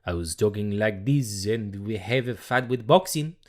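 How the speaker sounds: imitating a French accent; hammed-up French-accented English. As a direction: put on a comic French accent. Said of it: "I started talking French during boxing".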